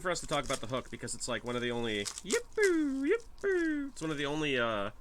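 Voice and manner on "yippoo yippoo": very high, squeaky yippee. Pretend. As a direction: squeal it out like a cartoon. Goofy squeal mid-sentence.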